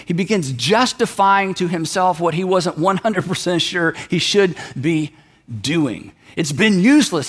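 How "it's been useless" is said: ordinary talk, nothing marked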